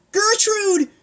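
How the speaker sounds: put-on girl yelling her friend's name; yelled. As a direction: yell across the pen. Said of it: "Pancake yelled from the pen".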